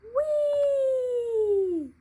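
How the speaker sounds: high, loud squeal of delight; gleeful whee. Acted. Little deer sliding into the leaves, high gleeful squeal. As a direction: let out a joyful whee while sliding. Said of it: "cried little deer".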